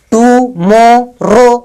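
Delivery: "Tomorrow" slowly split tu-mo-ro; slow, evenly divided "tomorrow"; three syllables. Shown as a demonstration. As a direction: sound out "tomorrow" with a gap after each syllable. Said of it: "तीन syllable".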